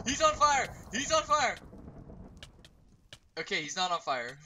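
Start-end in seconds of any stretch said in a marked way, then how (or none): 0.1-1.6 high frantic shouts; panicked yelling. Real, not acted. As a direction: yell it in alarm. Panic repeated.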